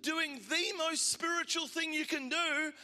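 fervent preaching. Acted. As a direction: drive the point home to the congregation. Preaching with rising force.

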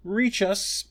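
high-pitched little echo. A narrator's reading. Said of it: "echoed Secunda".

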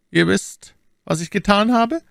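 high-pitched acted lady's question; startled Milady. A narrator's reading. Milady asking Athos what he knows, startled high voice. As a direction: question him sharply in a high female character voice.

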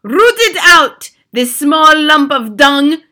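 slow, high, loud screech; Grand High Witch. A narrator's reading. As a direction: screech it, stretching every word. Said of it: "screeched the Grand High Witch".

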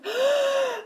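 high, strained gasp for breath; gasping for air. Acted. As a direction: gasp like a fish out of water. Fish stranded on the bank.

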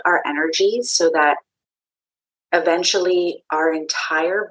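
calm meditation guide with long pauses; unhurried, grounding voice guiding listeners inward; guided meditation. Acted. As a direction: lead a meditation, leaving space between phrases.

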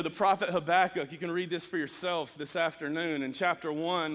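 ordinary talk

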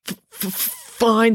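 halting stammer, each sound forced out slowly; stammering through pain. Acted. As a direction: choke out the word, holding back pain. Joe in pain, hiding tears.

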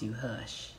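"hush" said soft and breathy, low and hushed; Southern coach telling students to go quiet, "hush" whispered low. Shown as a demonstration. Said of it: "we say hush".

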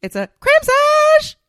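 loud, drawn-out excited shout. Acted. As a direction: belt it out like a hype announcement. Hyping up the episode.

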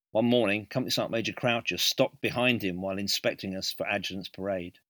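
ordinary delivery

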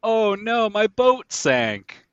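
high, loud fake lament; hammed-up cry of woe, drawn out at the end; mock dismay. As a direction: feign dismay for an insurance scam. Mock boat owner faking an insurance loss.

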